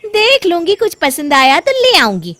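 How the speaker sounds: high, loud, sulky dismissal; pulling a face. Acted. Bahu grudgingly answers her mother-in-law, with a pout. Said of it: "साक्षी मुंह बनाती हुई".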